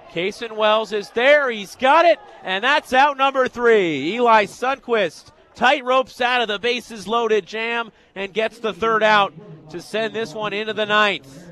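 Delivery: punchy call of a big catch; energized sportscaster wrapping up an inning; excited play-by-play. Real, not acted. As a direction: seize on the out, then ease down. Calls the third out live.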